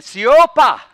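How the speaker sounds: "Siopa" barked high and very loud; forceful rebuke. Shown as a demonstration. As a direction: snap it at the wind like a command. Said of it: "That's what it really means".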